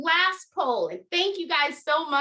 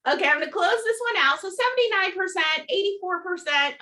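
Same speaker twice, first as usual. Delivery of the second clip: fast, high, caffeinated rush; racing through the numbers, wired; too much caffeine; 7 semitones higher than usual. Real, not acted. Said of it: "I think the coffee is kicking in".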